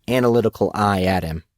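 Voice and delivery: ordinary delivery, nothing marked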